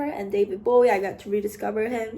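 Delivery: ordinary talk, nothing marked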